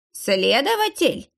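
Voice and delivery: slow, high alarmed echo; slow, high alarmed echo of the word; alarmed question. A narrator's reading. Old man echoes in alarm.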